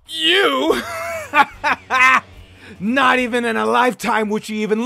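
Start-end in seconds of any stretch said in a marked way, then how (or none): 0.9-2.4 high, ringing burst of laughter; mocking laugh. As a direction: laugh right at them, high-pitched. Character laughs at the listener.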